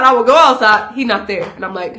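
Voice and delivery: normal talking voice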